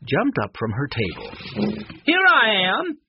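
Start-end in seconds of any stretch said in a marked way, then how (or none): 2.0-3.0 high, loud announcing call; bold, ringing show entrance; yelling. A narrator's reading. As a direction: holler it to the whole crowd. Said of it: "she yelled".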